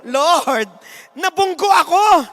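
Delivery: an indignant woman yelling her complaint; loud complaint. Acted. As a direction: shout the grievance up at him. Complaining to Lord.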